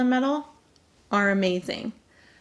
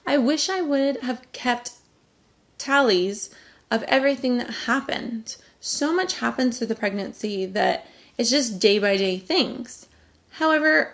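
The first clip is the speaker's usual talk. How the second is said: ordinary talk, nothing marked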